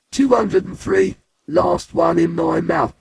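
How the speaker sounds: loud, high climax to the count; triumphant count. Acted. Boy counting his last raisin, triumphant. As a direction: build up to the very last one.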